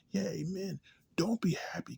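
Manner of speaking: sarcastic mock preacher. Sarcastic imitation of locals preaching.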